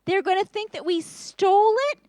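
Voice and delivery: high, loud crying wail; upset brothers. Acted. Brothers find money returned, wailing high and loud. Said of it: "And they were so upset".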